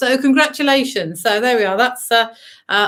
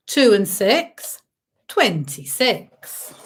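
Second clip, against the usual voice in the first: bingo caller; much slower than usual. Acted. Calls bingo numbers.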